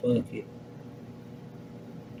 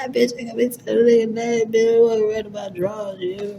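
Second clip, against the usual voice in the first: much higher, hyped-up squeal of a brag; giddy hype; 11 semitones higher than usual. Real, not acted. Bragging to the girls about his thighs, giddy and much higher. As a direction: crow about it like you already won.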